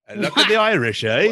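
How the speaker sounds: loud, high Irish attempt sliding into British; failed Irish accent, comes out British. Shown as a demonstration. Cohost retrying his Irish for friends, British-sounding Irish accent. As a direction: try Irish and land on British. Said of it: "That was British".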